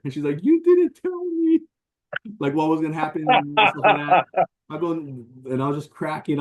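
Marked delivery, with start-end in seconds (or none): 0.4-4.5 higher, slow, whiny mock-crying complaint; imitating teen daughter. Teen daughter complaining to her dad about the film, mock teary whine. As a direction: play the upset teen daughter. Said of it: "she looking at me, like, crying".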